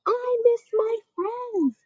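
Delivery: put-on high, tearful little girl; crying child voice. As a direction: cry it out like a sad kid. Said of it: "She cried".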